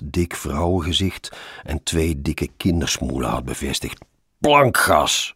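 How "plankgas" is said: high, loud single-word cry; calling out. A narrator's reading. Passenger egging on the driver, shouting out. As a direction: shout it out like a command. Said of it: "riep ik".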